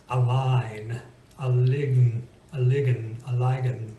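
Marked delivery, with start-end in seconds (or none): 1.4-3.9 "a lign, a ligin, a ligan" with a hard g sounded before the n; g pronounced. Shown as a demonstration. Teacher imagining how it would be pronounced, hard g in "a ligan". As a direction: try out "a ligan" several ways, g voiced. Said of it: "That would be hard".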